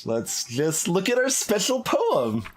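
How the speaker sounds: normal talking voice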